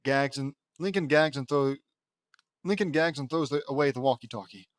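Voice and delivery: usual speaking voice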